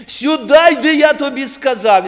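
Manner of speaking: loud commanding yell; shouting a child over. Retold or imitated. Father yelling at his son to come here. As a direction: call him over at full voice, angry. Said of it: "Я на весь голос".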